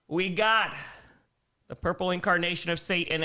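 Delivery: normal talking voice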